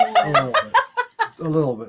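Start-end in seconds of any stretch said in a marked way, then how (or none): 0.0-0.7 squeaked through a laugh, much higher. Real, not acted. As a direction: tease while laughing. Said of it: "So hopefully I redeemed myself".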